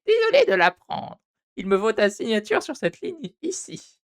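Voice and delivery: very high, hammed-up receptionist voice. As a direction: squeak it out like a sugary desk clerk. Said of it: "je vais faire les voix quand même".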